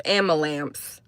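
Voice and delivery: "Amalamps", imitating a speech-impediment slip for ambulance; childlike mispronunciation. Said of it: "like, a speech impediment as a child".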